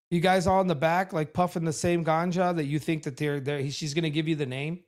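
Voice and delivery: ordinary talk, nothing marked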